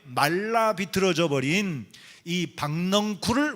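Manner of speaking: slow, drawn-out congregational reading; measured unison-style Bible reading; scripture reading aloud. Acted. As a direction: lead the congregation through the verse, swelling loud on key phrases.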